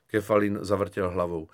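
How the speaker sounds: ordinary delivery, nothing marked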